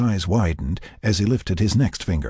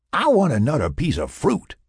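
confident demand; 8 semitones higher than usual. A narrator's reading. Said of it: "she demanded confidently".

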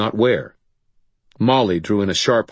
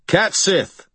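firm call; 12 semitones higher than usual. Acted. Wizard summoning the Malk, firm raised call. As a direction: call the spirit firmly by name. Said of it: "I called in a firm voice".

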